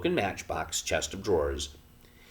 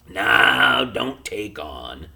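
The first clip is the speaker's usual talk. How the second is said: tired voice; 4 semitones higher, 9 dB louder, much slower than usual. A narrator's reading. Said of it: "said Pod in a tired voice".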